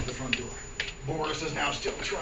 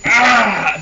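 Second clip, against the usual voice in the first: loud, raised cry; mock pained yell; 5 semitones higher, 15 dB louder than usual. Wounded Rasputin makes for the door, pained yell.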